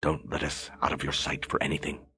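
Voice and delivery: hushed, firm order. Acted. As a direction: quietly warn the guard. Quiet order to guard.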